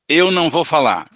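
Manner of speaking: defiant spirit shouting he won't talk; angry spirit. Acted. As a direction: bark the refusal as the angry spirit. Spirit's line read in role.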